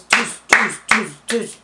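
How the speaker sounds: mimicking banging from upstairs with doof sounds; imitated thuds. Describing upstairs night noises to viewers, mock thudding sounds. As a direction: thump out each doof like a knock overhead.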